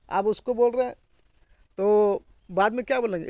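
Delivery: ordinary talk, nothing marked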